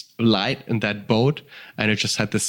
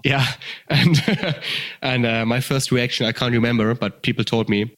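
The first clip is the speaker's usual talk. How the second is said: ordinary delivery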